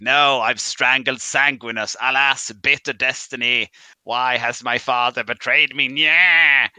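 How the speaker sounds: loud, slow, overwrought lament ending in a cry; hammed-up tragic lament. Joking about the lore, a hammed-up loud tragic Horus. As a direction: wail it like a doomed hero.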